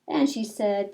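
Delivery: put-on reading a picture book aloud to children; lilting read-aloud, rhyme by rhyme; storytelling voice. Teacher reading Madeline to her class, storytelling voice.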